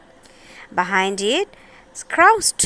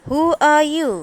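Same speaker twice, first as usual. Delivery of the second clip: higher, louder squeaky little wizard; 5 semitones higher, 4 dB louder than usual. A narrator's reading. Wizard greets Dorothy in a higher, louder squeak. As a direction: pipe it up like a tiny showman. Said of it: "said a squeaky voice".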